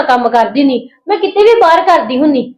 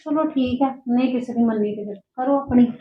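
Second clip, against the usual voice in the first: muttered, much softer resentful aside; grumbling under breath; 4 semitones lower, 11 dB quieter than usual. Acted. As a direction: grumble it half to yourself, softer. Maid grumbles after being scolded. (ਹੌਲੀ ਜਿਹੀ ਬੁੜਬੁੜ)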